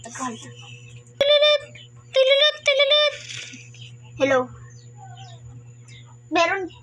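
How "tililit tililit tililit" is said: high trilled ringtone sound; rapid high-pitched mock ringing; phone ring imitation.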